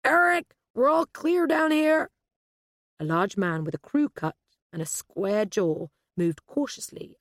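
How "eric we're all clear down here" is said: loud, high, slow call; shouting upstairs. A narrator's reading. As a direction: call the all-clear up to a colleague. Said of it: "then shouted up the stairs".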